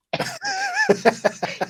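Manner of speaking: high, delighted laugh. Real, not acted. Laughs at own joke.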